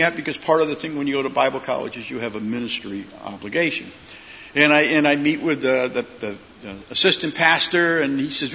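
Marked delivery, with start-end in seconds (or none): none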